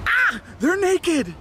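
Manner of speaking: gasp, then high shocked reveal; mock gasp of shock. Host teasing viewers about naked saunas, gasping in mock shock. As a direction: gasp like it's a scandal.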